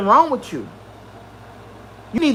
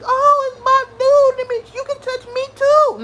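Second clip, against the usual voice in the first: starstruck fan voice; 12 semitones higher than usual. Retold or imitated. Mocking fans who meet [name], in a high gushing voice. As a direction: gush like a dazzled fan, pitched way up. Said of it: "They be".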